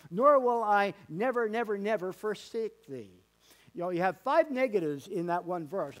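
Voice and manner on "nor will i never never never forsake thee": "Nor will I never" loud and high, the nevers pressed; intensified promise. Shown as a demonstration. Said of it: "It's an intensification".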